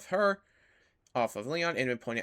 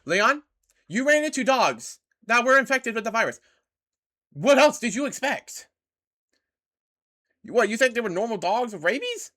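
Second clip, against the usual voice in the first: exasperated scolding; 8 semitones higher, 8 dB louder than usual. Real, not acted. Scolding game character.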